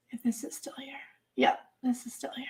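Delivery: unvoiced whisper while searching; whispered to herself. Real, not acted. Vlogger checking if a link is still up, whispering. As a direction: whisper it to yourself as you look.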